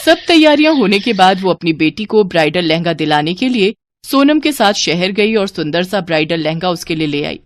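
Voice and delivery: ordinary delivery, nothing marked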